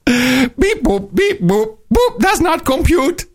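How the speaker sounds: high robotic beeps and bloops. Pretend. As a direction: play a malfunctioning robot president. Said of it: "this is not the robot".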